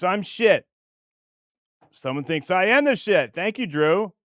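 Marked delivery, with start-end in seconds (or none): none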